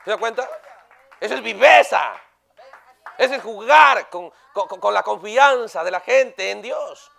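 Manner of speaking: loud, slowing preacher rebuke; a loud pulpit rebuke; fiery denunciation. Acted. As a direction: thunder it at the congregation. Preaching at full force.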